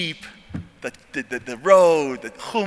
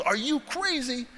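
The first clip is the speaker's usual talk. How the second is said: drawn-out, high, softer exasperation; incredulous disbelief; 7 semitones higher, 5 dB quieter, slower than usual. Retold or imitated. Trainee to his limping guy, slow high incredulity. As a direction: stretch it slowly upward in disbelief. Angry at injured teammate.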